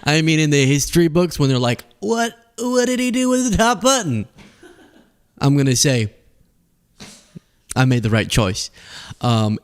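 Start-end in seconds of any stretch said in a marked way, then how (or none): 2.0-4.3 mock astonished questioner. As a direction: put on a high-pitched, baffled voice. Imagined quote from history books.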